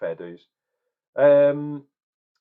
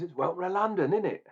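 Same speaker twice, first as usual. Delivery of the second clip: higher-pitched put-on London accent; 7 semitones higher than usual. Reviewer excusing the accent to viewers, jokey London accent. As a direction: play up the London accent on the tag question. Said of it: "I'm talking in a London accent".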